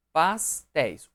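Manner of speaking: "Pastéis" split as pas, téis; syllable by syllable. Shown as a demonstration. Teacher separating another example, "Pastéis" syllable by syllable. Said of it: "separei herói".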